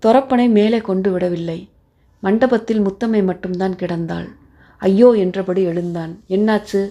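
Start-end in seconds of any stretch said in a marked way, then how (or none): none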